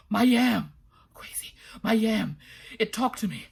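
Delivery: loud, urgent, breathless; frantic. A narrator's reading. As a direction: blurt it out in a panic. Frantic man telling news.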